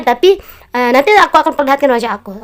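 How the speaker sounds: ordinary talk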